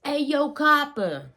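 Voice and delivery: high, put-on old-time New England accent; hammed-up New York-Boston mishmash accent; bad old-timey accent. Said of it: "I sound like I'm doing a really bad, like, New England accent".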